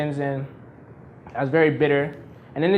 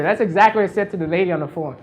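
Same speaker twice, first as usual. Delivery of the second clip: ordinary delivery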